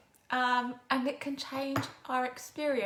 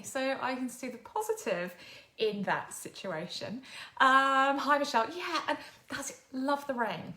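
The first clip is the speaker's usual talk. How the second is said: usual speaking voice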